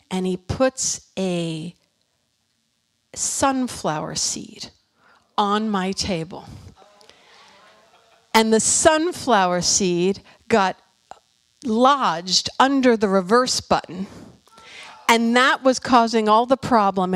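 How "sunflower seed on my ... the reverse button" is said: normal talking voice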